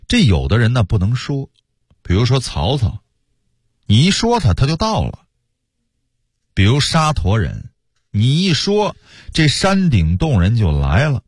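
ordinary delivery

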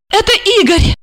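high eager cry of recognition; excited exclamation. A narrator's reading. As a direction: blurt his name out, thrilled. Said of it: "воскликнула она". (радостно воскликнуть имя)

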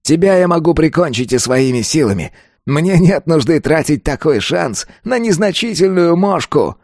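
high-pitched dismissive sneer; contemptuous villain. A narrator's reading. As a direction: belittle him as beneath notice. Killer sneers at bandit. (презрительно, высоким голосом)

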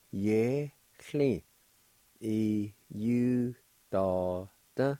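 "uh, ye, chli, e, u, da, da" with a gap after each syllable; measured teaching take of "uh, ye, chli, e, u, da, da"; syllable-by-syllable demo. Shown as a demonstration. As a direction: sound out "uh, ye, chli, e, u, da, da" piece by piece. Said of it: "And together".

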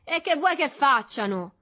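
high, loud scornful question; mocking rhetorical question; ironic. A narrator's reading. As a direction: throw it back with irony. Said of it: "disse Berto con ironia".

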